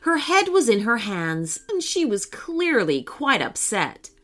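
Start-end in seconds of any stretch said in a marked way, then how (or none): none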